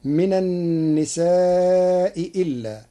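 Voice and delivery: slow recitation, very long aa in النساء before إلا; من النساء إلا recited. Shown as a demonstration. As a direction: draw out النساء, then move into إلا. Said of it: "قرأ ورش كلمتي من النساء". (من النساء إلا بمد طويل قبل الهمزة)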